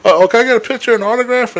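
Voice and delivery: high, fast excited fan asking; eager fan. Retold or imitated. Fan asking the wrestler for a picture, mock excited. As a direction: gush like a starstruck fan. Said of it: "he'd get the occasional".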